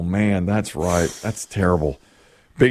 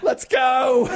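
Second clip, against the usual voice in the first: high, hammed-up getaway yell; comic high-pitched yelling; mock urgent shout; 13 semitones higher than usual. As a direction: hurry everyone out with a pretend shout. Acting out fleeing the restaurant.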